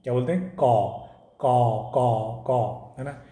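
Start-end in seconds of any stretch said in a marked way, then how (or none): 1.4-2.4 harsh imitated crow caws. Shown as a demonstration. Teacher imitating a crow for the class: harsh caws. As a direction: caw like a crow, twice. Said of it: "उसकी जो ध्वनि".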